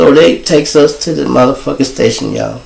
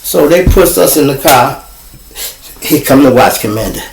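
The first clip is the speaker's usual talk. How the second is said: usual speaking voice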